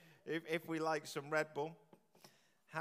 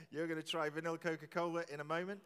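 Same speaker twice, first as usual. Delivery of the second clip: usual speaking voice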